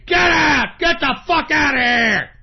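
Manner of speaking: bellowing to kick them out; angry shout. Acted. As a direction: throw them out at full volume. Rant boils over at listeners.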